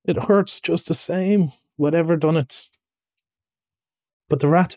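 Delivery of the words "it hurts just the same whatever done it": sorry for yourself, high-pitched; pained. Acted. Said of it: "forgetting his grammar in his pain".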